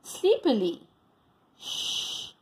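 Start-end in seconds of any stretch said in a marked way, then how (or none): 1.6-2.3 soft unvoiced hush; whispered shh. A narrator's reading. The duck hushing Lily to sleep, whispered. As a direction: breathe it out in a whisper. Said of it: "whispered the duck".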